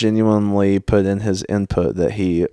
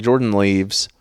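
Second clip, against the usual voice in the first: ordinary talk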